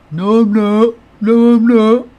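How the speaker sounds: high, loud whiny denial; indignant kid denial. Retold or imitated. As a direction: protest like a sulky kid caught out. Kids arguing, streamer imitates.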